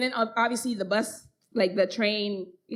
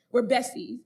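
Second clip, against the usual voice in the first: ordinary talk, nothing marked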